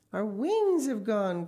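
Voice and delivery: delighted cry. A narrator's reading. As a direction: call it out in amazed relief. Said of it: "cried Mister Gregg".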